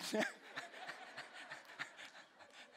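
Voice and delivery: soft airy laugh at himself; breathy laugh. Real, not acted. Preacher laughing at his own helpless breathing advice, soft airy laugh. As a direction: laugh quietly at the silliness.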